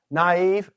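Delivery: Naive said nah-EEV, two syllables; split vowels. Shown as a demonstration. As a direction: Naive with the a and i pronounced separately. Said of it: "Naive is how we would pronounce it".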